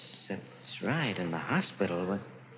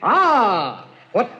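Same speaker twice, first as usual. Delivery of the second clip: loud, high, hammed-up delight; hammy excitement; 6 semitones higher, 4 dB louder, much slower than usual. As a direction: ham up the feast like a gleeful ogre. Hammed-up feast speech, loud.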